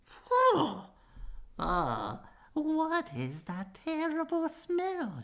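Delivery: high, slow squeal of disgust; disgusted old woman. Acted. Little old lady smelling the cheese man, high and disgusted. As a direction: recoil from the stench up high. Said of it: "she cried".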